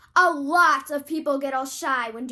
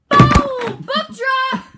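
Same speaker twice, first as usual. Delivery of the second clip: loud, higher yell of boom and tagline; shouted catchphrase; 9 semitones higher, 8 dB louder, much slower than usual. Acted. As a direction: slam it like a mic drop. Commercial catchphrase yelled out.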